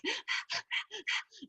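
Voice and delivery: sharp high gasp for air; mock breathless gasp. Instructor imitating a spinning rider, mock breathless gasp. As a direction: gasp like you're worn out mid-ride. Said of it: "You're like".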